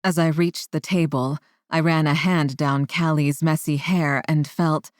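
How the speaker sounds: ordinary delivery, nothing marked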